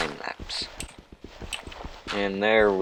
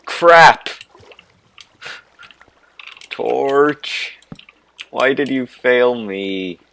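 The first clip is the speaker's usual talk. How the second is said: frustrated yell; 5 semitones higher, 8 dB louder, much slower than usual. Real, not acted. Torch fails while blocking water.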